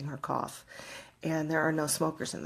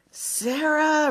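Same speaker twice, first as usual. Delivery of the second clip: loud, higher call of a name from behind; calling out a name loudly; 10 semitones higher, 6 dB louder than usual. Retold or imitated. Unseen voice calls her name loudly on the walk. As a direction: call the name out sharply across the street. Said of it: "really loud".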